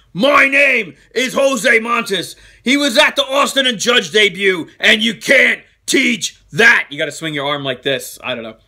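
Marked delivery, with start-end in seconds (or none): 0.1-6.9 slow, forceful wrestling catchphrase delivery; Enzo and Cass. Acted. Host reading a fan's comment as wrestlers' intro, Enzo and Cass voice. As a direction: boom it out word by word, stretching the last words. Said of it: "in the Enzo and Cass voice".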